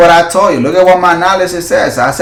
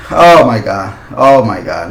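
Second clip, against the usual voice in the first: usual speaking voice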